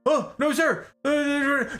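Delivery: high, stammering recruit voice; flustered recruit. Retold or imitated. Recruit denying it to drill instructor, flustered. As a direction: imitate a panicked recruit answering.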